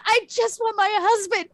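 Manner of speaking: high, loud, tearful wailing; acted panic. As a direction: wail hysterically for your family, hammed up. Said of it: "like, panic".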